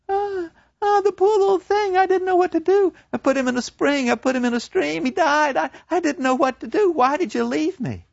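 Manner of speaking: put-on high, distraught girl pleading; a broken young woman begging her lover; Gretchen's high voice. To her lover, Gretchen gone mad with grief, high and pleading.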